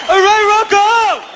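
slow, high pumped-up yell; excited shout. Retold or imitated. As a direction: holler it like a hyped fan. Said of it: "I fucking love it".

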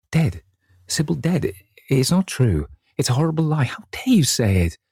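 high-pitched anguished disbelief; stricken, high-voiced protest; pained cry. A narrator's reading. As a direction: cry out in pain, refusing to believe it. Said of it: "A cry of pain broke from the lad's lips".

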